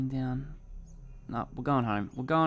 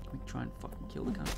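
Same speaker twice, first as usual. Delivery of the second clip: hushed urgent whisper; 7 dB quieter, faster than usual. Real, not acted. Hiding from attacking animal.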